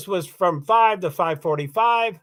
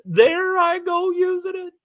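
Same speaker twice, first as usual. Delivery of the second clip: mock chiding; 12 semitones higher, 3 dB louder than usual. Real, not acted. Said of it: "I don't like that word".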